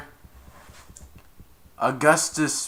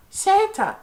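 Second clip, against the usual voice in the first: put-on high baby voice; 4 dB louder than usual. Baby Grinch voiced in retelling.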